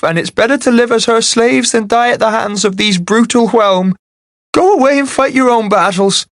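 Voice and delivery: high, thin anxious man; a nervous scrawny villager pleading. A narrator's reading. Beaky Nose voiced high.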